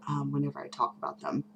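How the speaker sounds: ordinary delivery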